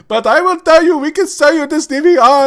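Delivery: very high, shouted imitation of an unravelling accent; hammed-up frantic salesman losing the British accent; slipping accent. Salesman losing it on the phone, in a loud slipping accent. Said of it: "accent was starting to slip".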